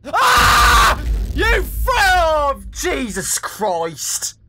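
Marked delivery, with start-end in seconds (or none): 0.0-1.9 sudden high scream, cut off; startled yell breaking off mid-word; terrified scream. Real, not acted. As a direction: shriek in real fright. Jump scare by killer doll.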